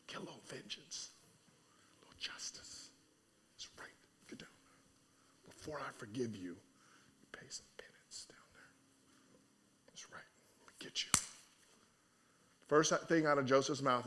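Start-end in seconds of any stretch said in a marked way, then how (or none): 0.1-11.1 barely audible whispered relish; gloating whisper. Pretend. Preacher imagining revenge on the brothers, gloating whisper. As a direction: whisper it to yourself, savoring payback.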